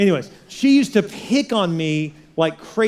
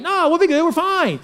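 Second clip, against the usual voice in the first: much higher, breezy mock teen voice; carefree teens; 11 semitones higher, 4 dB louder than usual. Pastor plays teens dismissing their parents, high mock carefree voice. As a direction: squeak it out like a kid brushing off danger. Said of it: "are like".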